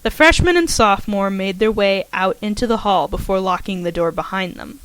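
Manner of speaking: ordinary delivery, nothing marked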